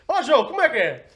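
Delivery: high, loud call across the room with a laugh; mock mom calling him back; imitating a mother calling out. Mother calls Joe back on FaceTime.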